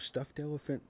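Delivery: high-pitched puzzled clarification, retold; imitated sister. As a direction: put on a little sister's voice. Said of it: "she goes".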